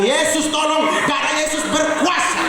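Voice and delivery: mock panic. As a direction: run away screaming for help. Laki-laki lari ketakutan. (jeritan panik pura-pura)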